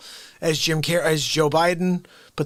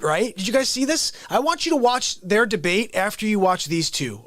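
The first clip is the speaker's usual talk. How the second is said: ordinary delivery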